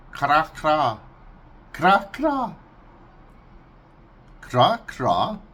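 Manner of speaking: loud kra kra duck call; duck quack impression. Shown as a demonstration.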